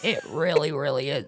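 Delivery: low, put-on character voice; Sue Dillon impression. Agreeing it's hard to talk normal, low character voice. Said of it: "after talking like that".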